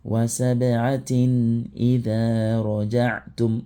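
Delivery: slow Quranic recitation with the ending sounded; recited continuing. Shown as a demonstration. Teacher shows students the reading that continues, slow and drawn out. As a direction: recite slowly, voicing the full ending. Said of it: "Lorsque tu veux continuer".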